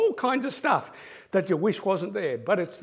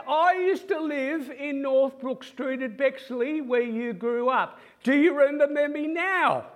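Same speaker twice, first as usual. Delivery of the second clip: calling out; 5 semitones higher than usual. Retold or imitated. Same man calls from the congregation.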